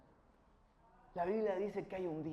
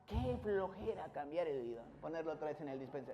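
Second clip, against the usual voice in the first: lazy grumble; 6 semitones lower, 7 dB quieter than usual. Retold or imitated.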